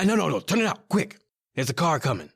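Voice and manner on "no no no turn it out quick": urgent warning. Acted. Car coming, urgent warning.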